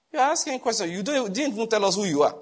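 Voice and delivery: a hint of a stern doctor's tone; rebuking tone. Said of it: "with a kind of tone".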